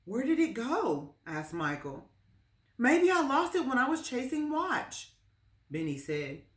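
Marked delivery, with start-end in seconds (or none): 0.0-1.2 put-on high, loud worried boy voice; alarmed, searching; panic. Lost dollar panic. 2.8-5.1 put-on high, loud fretful child voice; a small boy fretting; boy guesses where he lost his dollar, high and fretful; worried boy.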